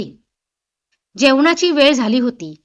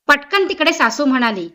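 ordinary delivery, nothing marked

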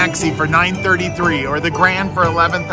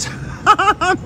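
squealing laugh of delight; high squealing laugh. Real, not acted. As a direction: shriek with laughter at the win. Jackpot win laughter.

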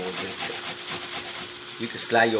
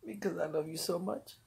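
tender; 6 semitones higher, 9 dB quieter than usual. Real, not acted. Tender words to his wife.

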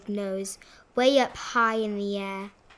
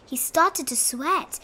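ordinary delivery

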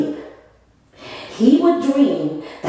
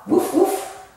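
high woofs; 8 semitones higher than usual. Acted. Recaps dog for kids.

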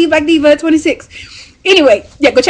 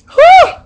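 short, high, loud whoop; delighted whoop; 9 dB louder than usual. Real, not acted. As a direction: whoop at a gorgeous scent. Reacts to smelling the lotion.

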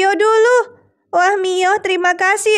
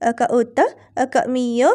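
put-on much lower toddler-talk character voice; baby Celine voice; 8 semitones lower than usual. Baby Celine greets her rescuers, low babyish voice. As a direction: babble the names as a little captive.

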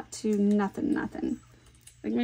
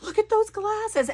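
high mock friend voice; lightly voiced amused friend; imitating friend; 12 semitones higher than usual. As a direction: squeal it like her excited friend. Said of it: "She was like".

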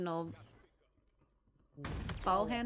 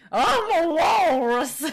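higher, loud, slow walrus bellow; walrus impression; 8 semitones higher, 12 dB louder, slower than usual. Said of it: "My walrus impression".

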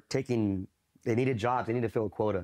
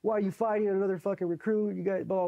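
drill instructor chewing out; 10 semitones higher than usual. Retold or imitated. Drill instructor chewing out a recruit, angry. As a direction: bark it like a furious drill instructor. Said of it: "they're chewing me out".

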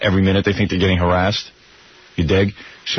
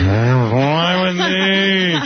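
very slow, higher dreamy croon; Allison Steele, sleepy; 7 semitones higher, much slower than usual. Retold or imitated. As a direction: stretch it out drowsily. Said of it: "No wonder she sounded a little sleepy".

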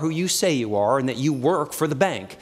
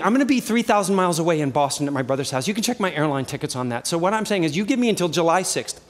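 ordinary delivery

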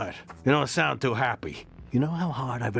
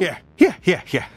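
loud impatient yeahs; 5 semitones higher, 9 dB louder than usual. Acted.

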